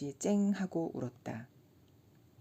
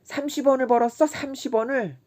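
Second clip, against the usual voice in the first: higher, fast, gleeful bragging; drunken glee; 8 semitones higher, 7 dB louder than usual. A narrator's reading. Kim crowing to Chisam about his earnings, high and gleeful. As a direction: crow about the money.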